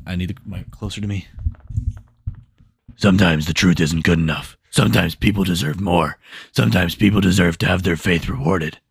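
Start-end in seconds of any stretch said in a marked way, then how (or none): 3.0-8.8 Batman voice. Acted. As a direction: growl it out loud like Bale's Batman.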